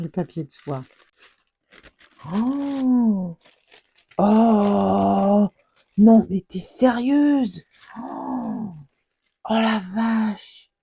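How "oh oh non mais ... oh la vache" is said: loud, slowed gasps of amazement; stunned loud delight; thrilled exclamations. Real, not acted. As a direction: cry out in disbelief at the gift. Opening a stunning gift.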